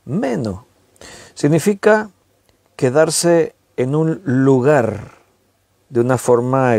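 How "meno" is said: "Meno" with the stress on the first syllable, ME-no; accent on the E. Shown as a demonstration. Teaching the Greek word for remain, "Meno" stressed on the E. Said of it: "con acento en la E".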